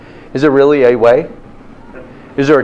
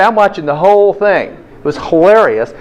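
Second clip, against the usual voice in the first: ordinary talk, nothing marked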